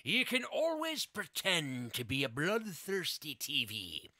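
slow, hearty pirate storyteller. Acted. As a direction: read it like a swaggering pirate. Reads the pirate Mad Lib in a pirate voice.

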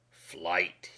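low, soft sinister word. A narrator's reading. Lieutenant offering the alternative to arrest, sinister. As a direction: drop it quietly like a veiled threat. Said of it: "he said in a sinister tone".

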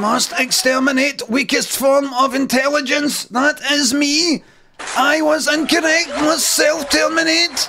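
doomed computer announcing its end; glitching robot. Retold or imitated. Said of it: "The computer's going all haywire".

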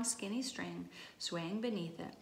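plain reading voice

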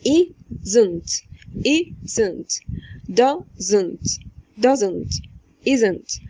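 "isn't" and "doesn't" split into two beats, iz-int, daz-int; two syllables. Shown as a demonstration. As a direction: sound both syllables clearly. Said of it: "هما مقطعين". (isn't و doesn't بمقطعين واضحين)

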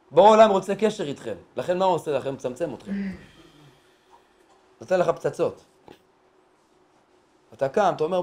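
ordinary talk, nothing marked

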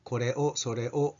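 normal talking voice

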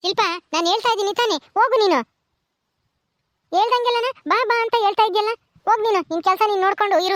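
put-on exasperated, shooing a pestering girl; irritated scolding. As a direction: shoo her away, annoyed. Scolding Shilpa to go away.

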